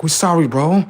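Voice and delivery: high, loud whiny begging voice; mock pleading AI. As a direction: plead like a scared program caught out.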